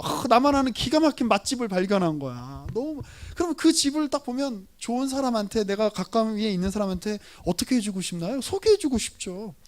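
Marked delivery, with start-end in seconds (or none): none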